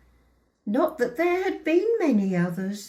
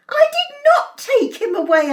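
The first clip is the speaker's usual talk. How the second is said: high, loud, emphatic denial; shouting; 8 dB louder than usual. A narrator's reading. Mother denying she drove father off, shouting. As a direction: shout it back in outrage. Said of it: "I shouted".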